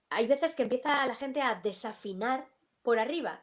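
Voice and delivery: normal talking voice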